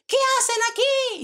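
high, loud challenge from behind; shout. Acted. Soldier startling the children at the river, high loud shout. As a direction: yell the question across the riverbank. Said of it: "oyeron un grito detrás de los niños".

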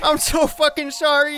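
tearful apology. Acted. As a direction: wail an apology to your lost child. Father reunites tearfully with son.

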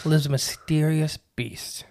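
slow, eerie whisper; spooky whisper. Pretend. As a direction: whisper it like a campfire tale. Spooky intro to the cryptid.

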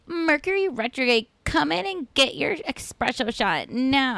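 high, drawn-out advertising spiel; mock storefront pitch. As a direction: imitate a sing-out sales slogan up high.

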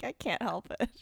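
breathy whispered excuse, half joking; mock whisper. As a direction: whisper it like a guilty confession. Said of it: "I'm like".

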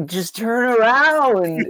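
slow, drawn-out mock yell up high; yelling at the screen. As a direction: beg the characters to turn around, slowly.

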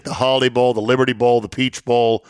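ordinary talk

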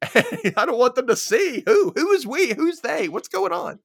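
high, slow, loud hysterical questions; mock paranoid panic. Mocking the character, he rattles off panicky questions. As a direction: ham up the frantic confusion. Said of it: "mocking the paranoid brother".

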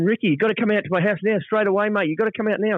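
high, urgent imitated panic; panicked plea. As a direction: beg him to come over right now. Longy panicking on the phone.

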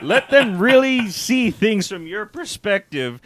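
salesman pitch broken by laughter; laughing infomercial. Pretend. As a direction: giggle through the sales pitch. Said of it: "infomercial sketch".

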